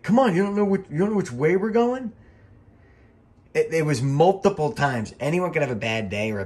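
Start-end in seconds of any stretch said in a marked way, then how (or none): 0.0-2.1 much higher, louder whiny mock complaint; whiny mockery. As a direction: mimic a pouting brat in the back seat. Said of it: "bitchy Steamboat Junior".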